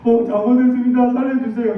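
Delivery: high, loud mock pleading; 겁먹은 아이 흉내. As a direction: beg for mercy like a tearful kid. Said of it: "눈물이 막 가득 차가지고". (울먹이며 비는 아이 흉내)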